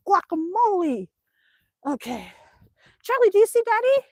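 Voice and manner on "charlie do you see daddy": high sing-song voice for the dog; pet talk. Real, not acted. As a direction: coo at the puppy. Talking to dog.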